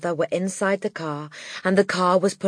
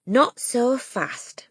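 slow, gentle restraint; slow her down kindly; calm caution; 6 semitones higher, much slower than usual. Acted. Miss Honey voiced.

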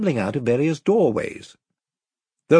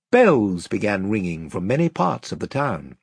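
usual speaking voice

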